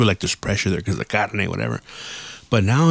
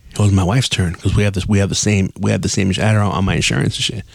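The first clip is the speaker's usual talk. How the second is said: ordinary talk, nothing marked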